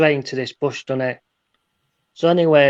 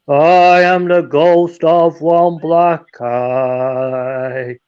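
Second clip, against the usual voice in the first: slow, drawn-out, eerie ghost moan; 5 dB louder, much slower than usual. Acted. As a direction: stretch every word like a spooky ghost. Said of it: "It's same voice".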